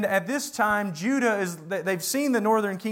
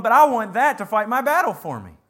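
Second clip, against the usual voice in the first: mock plea; 2 semitones higher, 8 dB louder than usual. Voicing people leaning on Egypt.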